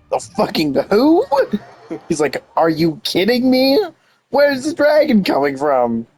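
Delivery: ordinary talk, nothing marked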